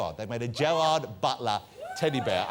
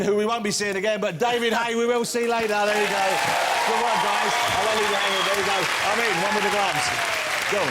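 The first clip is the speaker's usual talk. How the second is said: showman guest introductions; 6 semitones higher, 5 dB louder than usual. Acted. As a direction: announce each guest like a big-night showman. Host introduces guests, shouting names.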